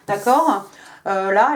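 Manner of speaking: normal talking voice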